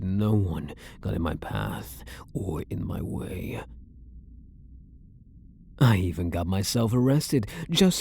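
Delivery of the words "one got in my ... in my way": low, secretive whisper. Acted. Obsessed prisoner whispering a dark secret to his guard.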